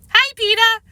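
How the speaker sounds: put-on high, loud little-kid greeting; gleeful high child's voice; little brother. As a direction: pipe up as a mischievous small boy.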